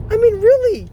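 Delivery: high and loud, exasperated; outraged disbelief. Real, not acted. Vlogger exasperated at the neglectful witch mother, high and loud.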